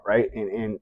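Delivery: usual speaking voice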